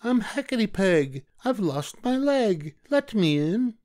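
very high, slow witch voice; cackling hag at the window, drawn out. Acted. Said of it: "called out".

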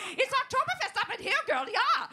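high, loud party whoop; party shout. Retold or imitated. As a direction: whoop it like a club MC. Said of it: "He's like".

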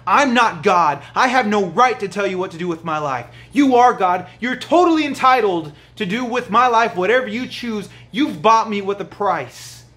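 fervent preaching. Acted. Preaching the leper's surrender.

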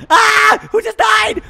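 very high, loud alarmed shout; startled shriek. Pretend. Reacting to a player's death, startled shriek. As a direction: shriek it in shock.

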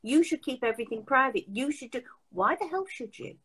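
usual speaking voice

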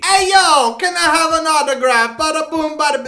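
loud, high New York holler; bad New York accent. Construction worker asking G D for an autograph, loud fake New York accent. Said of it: "the best New York accent I can do".

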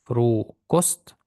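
"Фрукост" with long low "фру", rising on "кост"; low start, rising. Shown as a demonstration. Teacher repeats for learners, "Фрукост" from below upward. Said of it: "ми кажемо фру знизу".

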